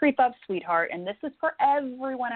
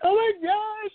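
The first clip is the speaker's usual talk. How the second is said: put-on high, squealing little-kid gasp; mother voicing her frightened inner child, high and panicky; 12 semitones higher, slower than usual. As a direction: squeal it like a scared small child. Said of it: "your inner child's gonna be like".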